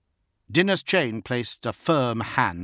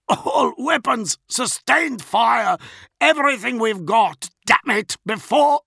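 higher, louder, slow shouted orders; shouting orders; 14 semitones higher, 7 dB louder than usual. A narrator's reading. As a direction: shout over the sirens. Desperate battle orders.

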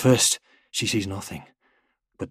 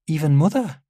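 put-on higher-pitched child asking, rising; child's questioning voice; 8 semitones higher, 5 dB louder than usual. A narrator's reading. Daughter asks her father about Mother, high child voice. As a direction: ask it as a little girl, high and unsure.

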